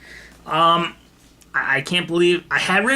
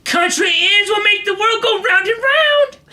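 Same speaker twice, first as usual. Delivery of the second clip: high hollered imitation; ranting bus passenger; 13 semitones higher, 4 dB louder than usual. Drugged man rants to the bus, high holler. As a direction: mimic a man proclaiming to the whole bus. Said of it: "he just like".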